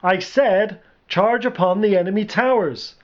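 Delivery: emphatic general's call; repeated command. A narrator's reading. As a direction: insist on the order to distant troops. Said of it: "Eddie repeated".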